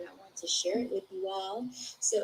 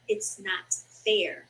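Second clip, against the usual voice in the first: slow sad remark; 3 dB louder, much slower than usual. Real, not acted. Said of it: "comment on reading".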